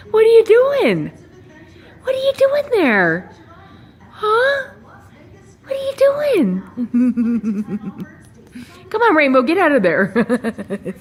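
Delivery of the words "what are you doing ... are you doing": very high, sing-song pet voice; high pet talk. Real, not acted. Owner asking her bird what it's doing, very high pet voice.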